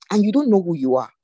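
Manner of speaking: ordinary delivery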